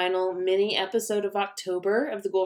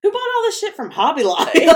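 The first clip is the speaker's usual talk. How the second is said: laughing mock outrage; 9 semitones higher, 5 dB louder than usual. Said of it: "be like".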